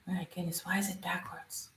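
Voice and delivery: very soft, puzzled aside; muttering to self. Real, not acted. Streamer puzzling over a backwards screen, muttering. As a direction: drop to a near-whisper, talking to yourself.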